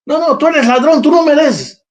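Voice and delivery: scornful crowd rejection. Retold or imitated. Preacher voices the crowd scorning Zacchaeus the thief, mock sneering.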